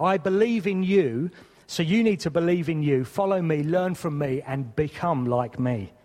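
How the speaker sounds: fervent preaching, lifted voice. Acted. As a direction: preach it with rising conviction. Sermon, Jesus' call voiced.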